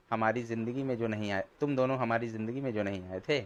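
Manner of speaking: plain reading voice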